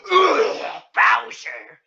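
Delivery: loud Bowser-style roar; mock monster growl. Reader playing the clawed giant, monstrous.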